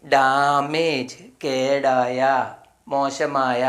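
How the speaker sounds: drawn out syllable by syllable; slow sounding out. Shown as a demonstration. Teacher sounds out damage very slowly for young learners. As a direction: stretch damage slowly so children hear each sound. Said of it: "എന്ത് വായിക്കും നമ്മൾ?".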